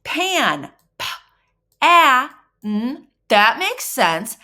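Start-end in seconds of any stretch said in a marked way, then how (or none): 0.0-3.1 Pan, p, a, n high and loud; segmenting sounds for a child; sounding out. Shown as a demonstration. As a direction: break Pan into p, a, n. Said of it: "let me see if I can do this".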